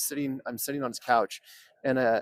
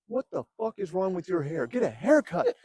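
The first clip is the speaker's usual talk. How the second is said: raised, high-pitched retold outburst; mock angry demand; 7 semitones higher than usual. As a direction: imitate an indignant man telling someone off. Retold hostile demand from a starer.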